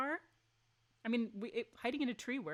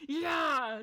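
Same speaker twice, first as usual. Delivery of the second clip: loud, higher character cheer; cheering yeah; 7 semitones higher, 9 dB louder than usual. Acted. Celine cheers the car throw, loud. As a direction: shout it in triumph. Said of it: "says Celine".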